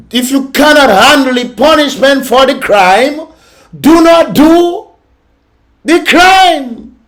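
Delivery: high, loud, slowing to hammer each word; rising scolding that slows right down on do not do; emphatic scolding. Real, not acted. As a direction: drive the moral home like a preacher.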